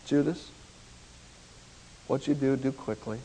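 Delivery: low, soft and grave; Jesus softly telling Judas to go; quiet command. Acted. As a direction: send him off almost under your breath.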